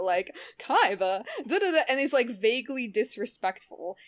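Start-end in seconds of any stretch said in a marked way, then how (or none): 0.6-1.8 mock twenties sassy computer lady, higher; sing-song sassy 1920s computer voice; hammed-up Betty Boop style computer. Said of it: "a sassy Betty Boop nineteen twenties style".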